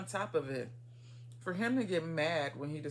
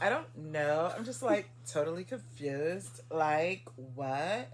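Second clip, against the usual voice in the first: bubbly Valley Girl impression; slower than usual. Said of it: "[name]'s Valley Girl voice".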